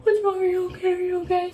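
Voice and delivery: high, fussing worried bystander; high-pitched mock-concerned cooing. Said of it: "everyone's gonna be like".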